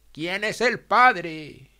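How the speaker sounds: slow, loud, hard question; demanding mother. A narrator's reading. Mother demands her daughter name the father, slow and loud. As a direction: press her for an answer, slowly. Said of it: "mamá entonces entró en el cuarto y dijo".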